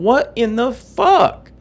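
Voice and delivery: high, drawn-out mock disbelief; freaked-out disbelief. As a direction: stretch it out like stunned onlookers. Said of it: "people are freaking the fuck out".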